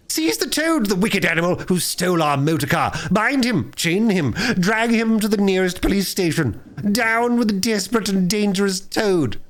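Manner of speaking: high, indignant hue and cry; party turning on Toad; outraged cry. A narrator's reading. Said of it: "they cried".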